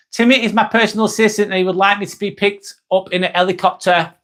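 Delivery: shouted, dropping every H; mock Cockney yell, no H sounds; dropped H accent. Reading a chat message aloud, shouting without H's. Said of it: "'Cause we don't say the H's".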